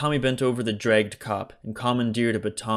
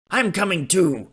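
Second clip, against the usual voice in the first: higher eager call; eager Leonard; 9 semitones higher, 4 dB louder than usual. A narrator's reading. Leonard insists on coming along, eager. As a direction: call out to join in. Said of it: "cried Leonard".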